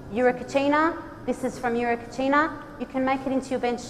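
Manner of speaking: normal talking voice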